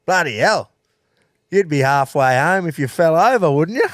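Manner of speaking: put-on old bloke's cheeky quip, loud and high; loud teasing jab from a stranger; loud mocking quip. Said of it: "And he just goes".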